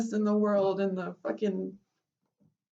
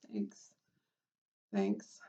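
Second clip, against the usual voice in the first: soft breathy thanks; 12 semitones lower, 8 dB quieter than usual. Real, not acted. Touched by comment.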